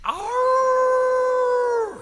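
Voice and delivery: long high howl held on one pitch; wolf howl. Shown as a demonstration. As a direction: lead the room in a wolf howl. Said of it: "we're gonna howl".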